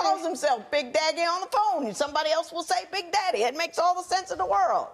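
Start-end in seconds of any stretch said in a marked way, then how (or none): 1.9-4.9 high, mock-earnest sarcasm; sarcastic judge. Real, not acted. Judge teasing wife that it all makes sense, sarcastic. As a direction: pretend to agree, dripping irony. Judge mocking wife's logic.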